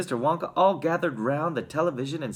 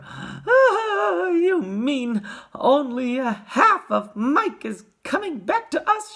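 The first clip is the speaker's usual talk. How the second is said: high, loud horrified wail; shriek, then wail it out slowly; horrified mother crying out; scream of horror; 14 semitones higher, 6 dB louder, much slower than usual. A narrator's reading. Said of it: "let out a scream of horror".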